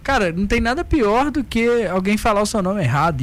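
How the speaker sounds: ordinary delivery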